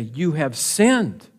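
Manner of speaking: slow, weighty pronouncement; solemn slow rebuke to the king; grave verdict. Retold or imitated. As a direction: lay the charge down slowly. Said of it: "look at what they say".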